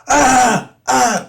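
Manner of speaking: high loud strained "ah, ah"; imitating a voice that can't form words; strained grunts. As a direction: push sound through a throat that won't work. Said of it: "it didn't come out right".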